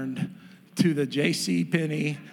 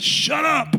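brother snapping; 9 semitones higher, 4 dB louder than usual. Retold or imitated. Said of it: "My brother said".

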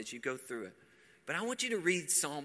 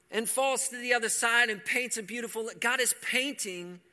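louder, higher, rising preacher's fervour; preaching at full force; 6 semitones higher, 9 dB louder than usual. Acted. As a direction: proclaim it to the whole room. Preacher builds on creation.

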